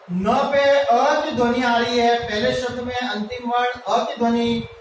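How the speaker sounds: normal talking voice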